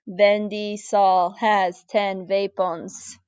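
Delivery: slow, spelled-out mock German-Russian accent; very slow German-Russian accented demonstration; mixed German-Russian-English accent. Shown as a demonstration. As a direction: draw out each word in a put-on German-Russian-English accent. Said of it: "You say it in kind of a mixed German, Russian, English accent".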